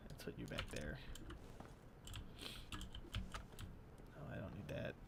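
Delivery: whispered to himself; whispering. Real, not acted. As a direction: breathe it under your breath. Whispering to himself over the inventory.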